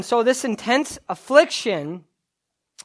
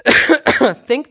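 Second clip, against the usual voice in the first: coughing mid-sentence; words broken by a cough; coughing fit; 3 semitones higher, 7 dB louder than usual. Real, not acted. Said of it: "I'm coughing a lot".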